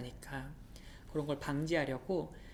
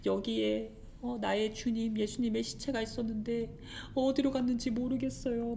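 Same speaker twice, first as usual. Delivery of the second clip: tearful; 6 semitones higher than usual. Acted. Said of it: "마리아가 울면서".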